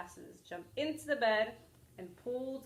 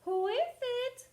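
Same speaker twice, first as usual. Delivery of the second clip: put-on higher, louder call of an old lady; high old granny call; 5 dB louder than usual. Granny asks who is at her door.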